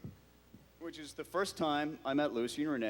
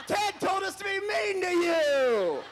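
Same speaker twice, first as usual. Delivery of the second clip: much higher laughing shout; 6 dB louder than usual. Retold or imitated. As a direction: cackle it out, laughing. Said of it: "come back in like".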